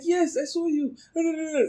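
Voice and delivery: voicing the girl sweetly; high girl's reply. The girl answering the narrator, voiced high.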